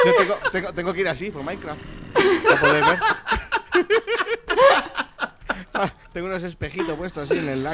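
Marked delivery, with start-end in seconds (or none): 3.0-5.9 high, prolonged laughing; uncontrolled laughter. Real, not acted. Laughing at the big helmet joke, uncontrolled. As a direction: burst out laughing and keep going.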